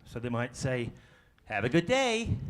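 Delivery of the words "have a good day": upbeat bad American accent; very positive, badly faked American, rising at the end. Shown as a demonstration. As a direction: put on a chirpy American accent. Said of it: "my very bad American accent".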